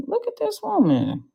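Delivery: high, astonished squeal at her videos; mock disbelief. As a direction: shriek it in amazed disbelief. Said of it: "I'm like".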